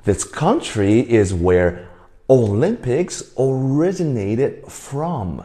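each word slowly and clearly; slow, clear repeat; slow repeat for the class. Shown as a demonstration. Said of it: "我刚刚说什么".

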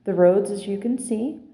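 ordinary talk

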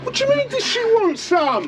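high disbelieving question; can't believe the threat; incredulous. Real, not acted.

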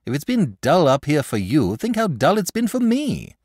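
put-on earnest pleading boy; imploring. A narrator's reading. Boy pleading with the dragon to fight. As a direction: urge him, half begging. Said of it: "said the boy imploringly".

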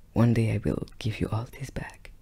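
whispered, menacing promise; ominous whisper. Retold or imitated. Girlfriend hinting at revenge in the car, whispered. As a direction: breathe it like a secret threat.

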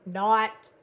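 "Not" broken by laughter; laughing. Real, not acted. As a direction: laugh through the punchline. Laughing at joke.

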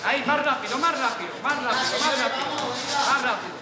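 loud, insistent repeated command; urgent coaching shout. Real, not acted. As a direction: drive the boxer on with every shout.

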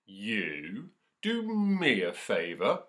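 loud, slow lion's laughing roar; lion mocking a tiny mouse, loud; roaring with laughter. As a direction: bellow it as if the idea is a joke. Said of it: "roared the lion with laughter".

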